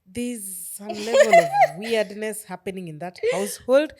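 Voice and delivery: loud, slow, laughing through it; cracking up in disbelief; loud slow amused remark. Real, not acted. Laughing at weird DMs.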